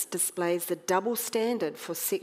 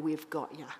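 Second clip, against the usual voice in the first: soft, smug smirk in the voice; smirking gloat; 4 semitones lower, 7 dB quieter than usual. Acted. Pharisees gloating at trapped Jesus, soft smug smirk. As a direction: gloat quietly, sure you've won. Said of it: "with a sort of slight smirk".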